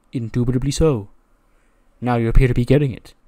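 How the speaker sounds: a lightly voiced proud mentor patting a shoulder; pleased pompous mentor. [name] pats shoulder, pleased.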